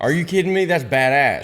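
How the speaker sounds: high, loud, thrilled outburst; real delight. Real, not acted. Host hearing of grandpa's dog's name, delighted. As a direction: burst out in amazed approval.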